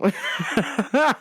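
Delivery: short burst of laughter; amused cackle; laughing. Real, not acted. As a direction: crack up at your own advice. Laughing at own advice.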